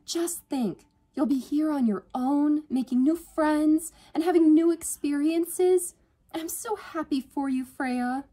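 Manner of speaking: high, loud sisterly gushing; swooning. A narrator's reading. Sister cheering on a new college student, swooning. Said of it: "She swooned".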